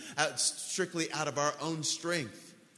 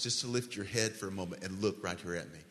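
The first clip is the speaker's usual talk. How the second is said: ordinary delivery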